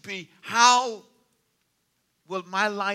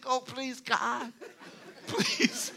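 mock prayer; 4 semitones higher, 4 dB quieter, much slower than usual. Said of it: "I'm thinking".

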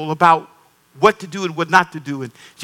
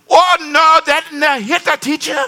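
shocked visitor; 10 semitones higher than usual. Retold or imitated. Pastor imitating his guest, high and horrified. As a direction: gasp out the denial pitched up high.

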